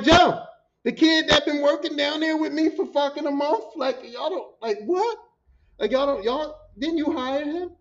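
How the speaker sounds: high, loud start, then baffled sputtering; incredulous reply. Retold or imitated. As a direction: insist in disbelief that they must know him. Incredulous reply to forgetful bosses.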